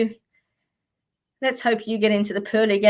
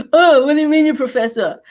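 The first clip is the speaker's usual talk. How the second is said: quick, louder mock caller voice; lightly mocking the caller's odd voice; funny caller voice; 5 semitones higher, 5 dB louder, faster than usual. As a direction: rattle it off like the strange caller. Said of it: "he had this real funny voice".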